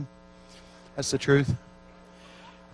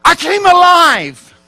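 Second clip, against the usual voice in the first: a high, loud, slow burst of joy; explosive cry; 14 semitones higher, 13 dB louder, much slower than usual. Retold or imitated. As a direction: shout it out like new life breaking through. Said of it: "he said in the most explosive terms".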